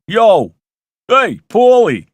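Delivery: loud, high, drawn-out Brooklyn yell; big Brooklyn-accented call; Brooklyn shout. Acted. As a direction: holler it like a Brooklyn caller. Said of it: "shouting him in a Brooklyn accent".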